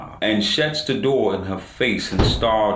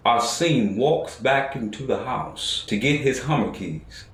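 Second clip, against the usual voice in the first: usual speaking voice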